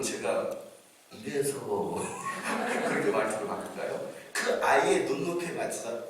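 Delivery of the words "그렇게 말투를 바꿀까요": ordinary talk, nothing marked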